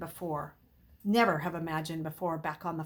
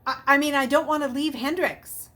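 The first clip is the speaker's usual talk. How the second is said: insistent; 7 semitones higher, 8 dB louder than usual. A narrator's reading. Boy insisting on staying.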